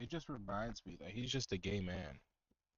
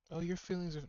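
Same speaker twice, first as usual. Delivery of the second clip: mock nurse; 8 semitones higher than usual. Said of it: "mocking the nurse's soothing line".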